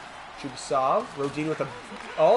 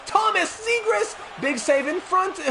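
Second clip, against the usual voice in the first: excited call; 5 dB louder, slower than usual. Real, not acted. Big save in front.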